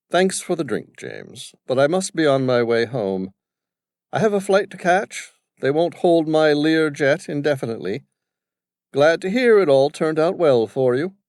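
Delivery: a hint of aristocratic British accent; upper-class British accent. Gentleman taking leave of James, upper-class British accent. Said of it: "upper-class British accent".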